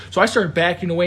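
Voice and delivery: normal talking voice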